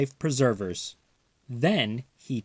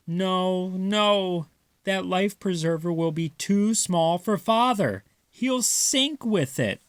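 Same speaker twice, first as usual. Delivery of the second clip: higher Mother voice, gently worried; 7 semitones higher than usual. Acted. Mother warning the mice the ring is too small, gently worried. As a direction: shake your head as you say it.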